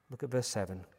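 ordinary delivery

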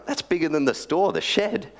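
high, incredulous exclamation; astonished customer. Acted. Customer gaping at the huge shed, astonished. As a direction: sound stunned by the size of the shed.

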